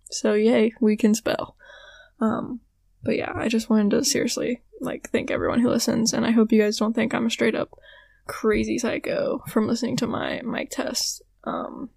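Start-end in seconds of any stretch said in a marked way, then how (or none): none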